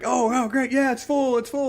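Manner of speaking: very high, excited cheer; high-pitched jubilant exclaiming; cheering class. Retold or imitated. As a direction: celebrate like students who got it right. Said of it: "they're, like, cheering, high-fiving".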